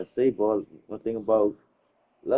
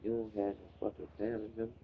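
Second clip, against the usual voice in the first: inner thought, quiet; 14 dB quieter than usual. Retold or imitated. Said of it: "all I could think about".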